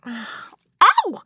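sharp real yelp of pain. Real, not acted. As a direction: yelp as the finger hits the eye. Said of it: "why are you laughing".

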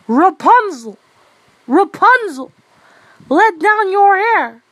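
high, loud, sing-out call. A narrator's reading. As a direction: holler up to a tower, stretching the last words. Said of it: "came in and cried".

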